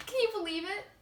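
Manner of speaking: bubbly, over-the-top airhead; ditzy teen girl. Acted. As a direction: gush like a vain new class president.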